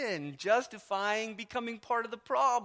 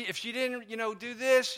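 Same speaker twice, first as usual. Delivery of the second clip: ordinary talk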